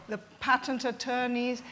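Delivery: ordinary delivery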